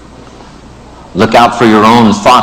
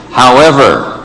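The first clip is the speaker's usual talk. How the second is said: "However," set apart, higher and emphatic; stress on However; 4 semitones higher than usual. Acted. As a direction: underline "However," as the story's turning point. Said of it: "underline the word however".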